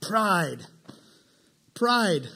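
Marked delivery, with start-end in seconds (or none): none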